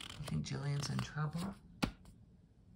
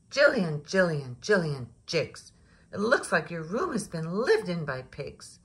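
loud scolding call, much louder; angry mother; 3 semitones higher, 8 dB louder than usual. A narrator's reading. Mother shouts at Jillian about the pig-sty room. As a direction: yell her name like an angry mom.

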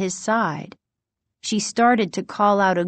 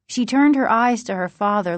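ordinary delivery

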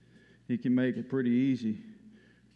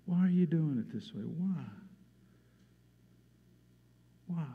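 gentle Jesus voice; 5 dB quieter than usual. Acted. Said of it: "Jesus ... says".

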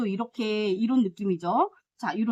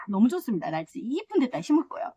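ordinary delivery

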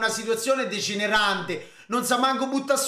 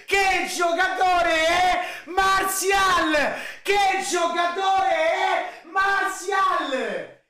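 amazed slow shouting; 7 semitones higher, slower than usual. Real, not acted. Repeated amazed shouting at pass.

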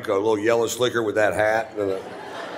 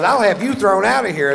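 shrill mock threat; 11 semitones higher, 4 dB louder than usual. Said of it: "She goes".

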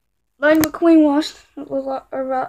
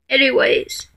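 ordinary talk

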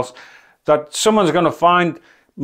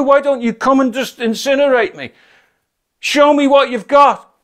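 angry shouting; 7 semitones higher than usual. Retold or imitated. Man in a rainy field shouting a dare at the devil, furious. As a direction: yell up at the sky, daring him to strike. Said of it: "And I was getting really mad".

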